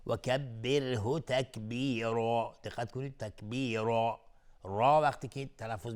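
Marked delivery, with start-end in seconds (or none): 0.1-2.6 slow recitation, light R in «کبره», heavy R in «تکبیرا»; thin then thick ra. Shown as a demonstration. 3.2-4.2 «تکبیرا» recited with a heavy, rounded R; thick ra. Shown as a demonstration.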